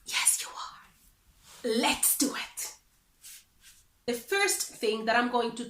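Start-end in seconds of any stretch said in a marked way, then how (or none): 0.1-2.7 slow breathy whisper of reassurance. Acted. Teacher whispering encouragement to the viewer, slow whisper. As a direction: whisper it like a secret to the learner. Teacher answers own question softly.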